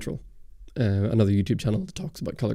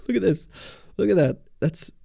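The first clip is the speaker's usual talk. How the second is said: higher, eager show-and-tell; pointing it out with real excitement; thrilled to show the viewers; excited; 8 semitones higher than usual. Real, not acted. Showing bonfire pictures to chat.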